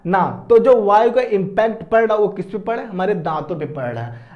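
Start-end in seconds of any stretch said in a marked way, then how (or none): none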